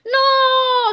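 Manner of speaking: high, loud drawn scream; long shrieking No. A narrator's reading. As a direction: scream as he's kicked away. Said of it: "screamed Big Tippy".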